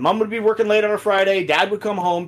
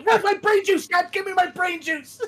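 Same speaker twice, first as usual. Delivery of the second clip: very high crazed yelling; manic yell; 13 semitones higher than usual. Retold or imitated. Gamer killing every enemy, crazed high yell. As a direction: scream for it like a madman.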